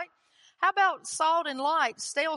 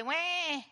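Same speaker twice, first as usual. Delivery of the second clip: a short, high imitated baby cry; mock baby wail; 5 semitones higher than usual. Preacher imitates a fussing baby for the church, mock wail. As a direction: wail like a toddler who didn't get his way. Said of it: "like babies".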